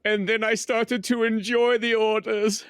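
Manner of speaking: a slow, sly, hammed-up German accent; mock German accent. German accent as a cinema worker, slow and hammed-up.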